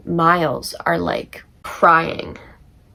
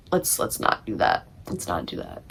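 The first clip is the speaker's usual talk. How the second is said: hushed plea; 11 semitones lower, 9 dB quieter than usual. Retold or imitated. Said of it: "at the time I was like".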